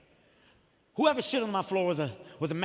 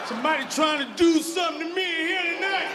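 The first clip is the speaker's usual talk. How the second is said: loud, high, slurred panic; drunken panic; 7 semitones higher, 4 dB louder than usual. Retold or imitated. Drunk man in the club panicking loudly, high yell. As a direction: yell like someone drugged your drink.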